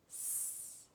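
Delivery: S as a long soft hiss, not the letter name; letter sound demo. Shown as a demonstration. Teacher gives first sound of sandcastle, long hissed S. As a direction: hold only the voiceless sound of "S". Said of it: "What sound?".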